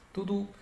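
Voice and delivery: short "tu", then a long high "tu"; bus horn imitation. Shown as a demonstration. (buzina de ônibus, segundo "tu" alto)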